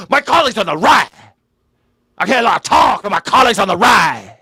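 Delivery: a loud, hammed-up politician voice; mock pompous politician. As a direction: ham up the stuffy colleague talk. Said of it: "do you ever hear people talk like that".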